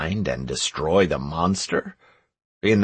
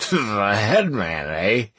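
put-on drunken mumble; slower than usual. A narrator's reading. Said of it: "mumble in a drunken voice".

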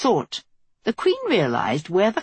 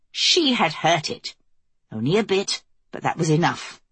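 usual speaking voice

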